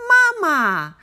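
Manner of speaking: calling to her family across the stream; shouted. A narrator's reading. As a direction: glad duckling cry. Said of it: "the duckling shouted".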